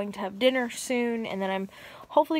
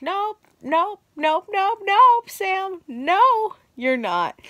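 mock taunting voice; 10 semitones higher, 5 dB louder, much slower than usual. Mock voice rejecting her progress.

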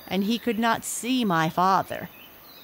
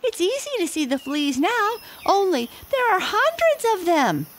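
very high squeaky delight; amazed monkeys; 14 semitones higher, 4 dB louder than usual. A narrator's reading. Six monkeys marvel at the glasses, very high and squeaky. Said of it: "said the six little monkeys".

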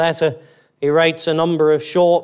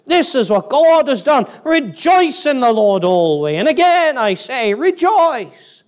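climbing to a high, loud cry on rejoice; passionate preacher; 9 semitones higher, 4 dB louder than usual. Acted. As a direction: proclaim it like Paul bursting with joy. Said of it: "You can hear it in him".

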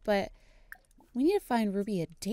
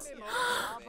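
sharp shocked gasp; 12 semitones higher, 8 dB quieter than usual. Real, not acted. Reacting to rejection.

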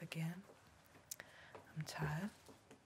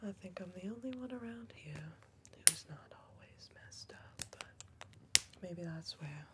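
whisper. Acted. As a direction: keep it all breath, no voice. Whispered roleplay throughout.

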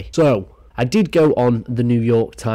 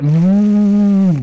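drawn-out higher loud mm; mock relish; 10 semitones higher, 8 dB louder than usual. Admiring the jigsaw tool, slow loud relishing mm. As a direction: savour it slowly.

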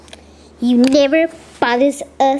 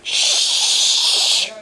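usual speaking voice

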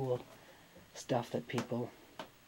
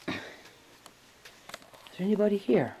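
raised, high-pitched call into silence; 7 semitones higher, faster than usual. Real, not acted. Ghost hunter asking if any spirit is here, calling out. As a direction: call out to an unseen presence.

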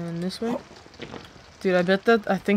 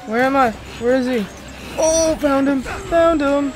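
high loud panicked calls; frantic under pressure, near shouting; 6 semitones higher, 7 dB louder than usual. Real, not acted. Chased, searching in panic.

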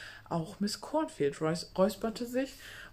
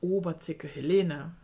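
usual speaking voice

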